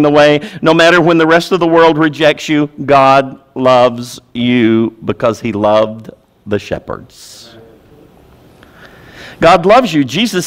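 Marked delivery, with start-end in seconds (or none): 2.8-7.6 tender hush. Real, not acted. Preacher softens to tender aside.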